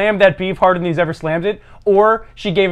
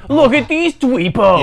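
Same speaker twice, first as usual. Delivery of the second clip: high, drawn-out bully taunt; jeering bully; 7 semitones higher, 4 dB louder, slower than usual. Acted. Mocking fake Hollywood bullying, high jeering taunt. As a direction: jeer like a fake movie bully.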